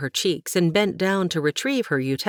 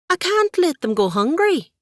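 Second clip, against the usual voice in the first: high, louder pleading argument; arguing; 13 semitones higher, 5 dB louder than usual. Acted. Katie argues with her aunt, loud and high. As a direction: argue back hard. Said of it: "she argued".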